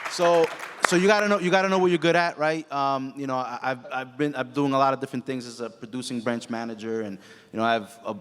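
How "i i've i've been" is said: ordinary talk, nothing marked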